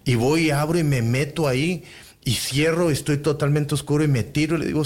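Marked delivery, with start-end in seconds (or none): none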